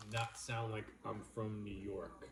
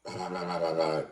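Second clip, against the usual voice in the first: a burst of real laughter, louder; 5 semitones lower, 10 dB louder than usual. Real, not acted. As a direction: let a hearty laugh break out. Big laugh after accent talk.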